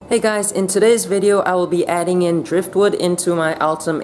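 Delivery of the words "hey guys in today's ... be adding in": bright, loud vlog hello; upbeat greeting. Real, not acted. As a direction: greet the viewers with energy. Energetic vlog intro greeting.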